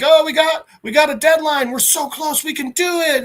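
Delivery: high-pitched, pressured rallying; high, harried pep talk; urgent crew member. Acted. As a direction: urge the team on in a high voice. Crew urging each other on deadline.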